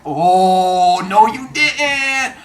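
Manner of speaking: drawn-out, sassy no you didn't; mock-scandalized crowd; sassy crowd gasp. Crowd teasing Arachne with slow sass. Crowd reacts to trash talk.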